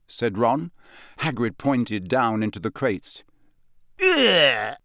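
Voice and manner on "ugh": high, sharp squeal of disgust; girl recoiling from slimy creatures. Acted. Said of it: "squealed Lavender Brown".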